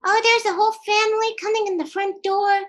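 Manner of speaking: ordinary delivery, nothing marked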